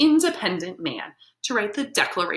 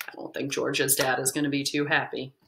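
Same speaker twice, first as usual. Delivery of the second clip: plain reading voice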